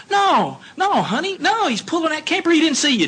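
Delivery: high pleading wife, lightly mocked; wife's voice. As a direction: play the worried wife calming her husband.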